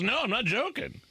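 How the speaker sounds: high imitation of Biden; mock Biden. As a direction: lightly voice Biden's insistence. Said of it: "He goes".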